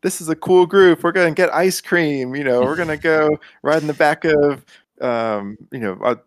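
high, bright salesy youth pitch; mock youth counselor. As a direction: oversell the fun like a cheesy youth leader. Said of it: "it was all like".

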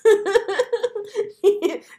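loud high put-on laugh; loud mock laugh. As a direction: burst into a performed laugh.

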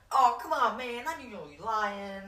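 high, loud imitation of a pleading hustler; mock protest. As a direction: act out an exasperated street seller. Seller's pleading protest acted out.

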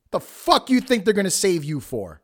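ordinary delivery

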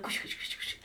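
hushed unvoiced whisper. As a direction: whisper it like kids gossiping. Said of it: "they were like".

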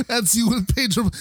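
ordinary talk

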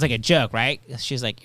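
usual speaking voice

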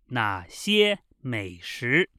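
slow, word-by-word dialogue reading with pauses; measured Mandarin, each phrase set apart. Shown as a demonstration. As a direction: read very slowly for learners to repeat. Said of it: "this time very slowly".

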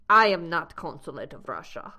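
put-on low, clumsy Russian accent; bad Russian accent. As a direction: deny it as a badly imitated Russian. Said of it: "I'm doing a very bad Russian accent".

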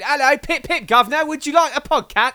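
high, loud hammed-up posh British accent; mock posh British. British guy hamming up his Britishness for Americans, mock posh British accent. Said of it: "the single most British sentence I said was".

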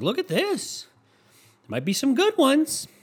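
lightly voiced high-pitched show-off; imitated oyster seller. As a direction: pitch it high, like presenting a find. Voices oyster sellers showing wares.

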